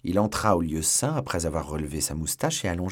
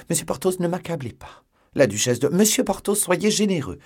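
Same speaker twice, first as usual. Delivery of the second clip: much higher, louder pleading; pleading woman's voice; 10 semitones higher, 6 dB louder than usual. Acted. La procureuse supplie Porthos d'être généreux, très aiguë. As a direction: beg him, voice climbing high.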